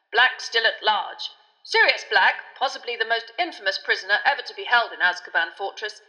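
loud, projected news-reading voice; newspaper report read aloud. A narrator's reading. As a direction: announce it like an urgent bulletin.

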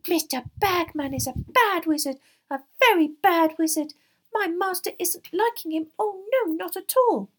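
high, loud, scornful elf squeak; shrill, angry house-elf. A narrator's reading. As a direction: denounce him in a squeaky fury. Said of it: "she looked angry again".

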